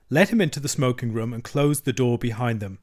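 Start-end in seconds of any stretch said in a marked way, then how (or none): none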